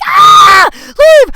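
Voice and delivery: a very high, loud wail of "leave"; desperate inner scream. Retold or imitated. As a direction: scream it at him in your head. Inner panic, needs the bathroom.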